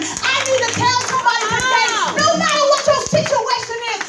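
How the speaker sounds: rising church exhortation into prayer; preaching at full force. Acted. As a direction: whoop it up to the congregation.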